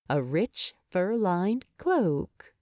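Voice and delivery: usual speaking voice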